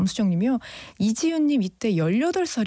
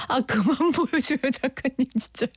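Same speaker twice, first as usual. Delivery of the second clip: laughing plea; 3 semitones higher than usual. Real, not acted. Begging writer while still laughing.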